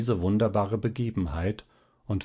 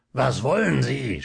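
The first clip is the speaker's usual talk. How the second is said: sharp mock shout; a touch of a yell; 2 semitones higher, 3 dB louder than usual. A narrator's reading. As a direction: bark the question at the doctor. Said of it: "schrie Zinnober".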